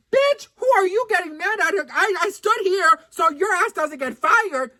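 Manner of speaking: high, loud mock outrage; acted indignant outburst. Customer outraged at an ungrateful saleswoman, high indignant yell. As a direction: let loose as if telling her off.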